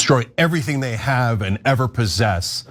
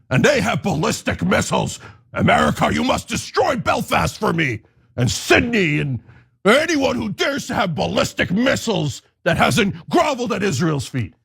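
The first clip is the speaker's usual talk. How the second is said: higher, shouted, imperious demands; mock Israeli demand; 5 semitones higher than usual. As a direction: bark orders at America like a spoiled master. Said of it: "Soon it'll be like".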